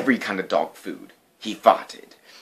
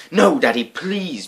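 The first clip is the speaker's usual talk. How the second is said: put-on begging child; 7 semitones higher, slower than usual. A narrator's reading. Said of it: "begged Betty and Billy".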